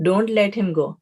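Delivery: ordinary talk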